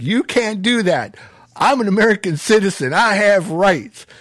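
high-pitched mock outrage, imitating the arrested cousin; indignant protest.

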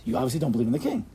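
usual speaking voice

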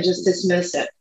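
normal talking voice

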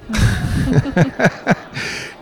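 high burst of laughter. Real, not acted. Guest finishing the miracle ring story, real high laugh.

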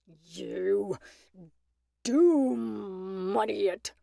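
low, slow, menacing wolf voice; savage wolf retort. A narrator's reading. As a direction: draw the words out savagely. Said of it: "retorted the wolf savagely".

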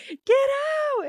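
very high, loud outburst; acted high-pitched shout to leave; mock panicked yell. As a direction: squeal it like someone walked in on. Retold man caught in the bathroom.

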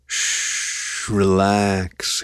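slow honeyed murmur. A narrator's reading. Pearson calming her from above, in a slow honeyed murmur. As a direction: murmur it slowly, sweet and calming. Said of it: "he murmured from above her, voice back to that slow honey".

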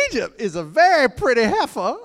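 high, playful divine voice; a high teasing proclamation; God's amused tone. Shown as a demonstration. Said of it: "this tone".

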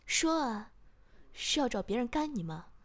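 loud, forceful interrogation; harsh demand. A narrator's reading. Master demanding an answer mid-punishment, harsh and loud. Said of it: "我边打边说".

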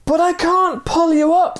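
very high, strained mock shout; a high, desperate cry down the ravine; storytelling cry. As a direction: cry out in frustration, voice way up. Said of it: "the man cried".

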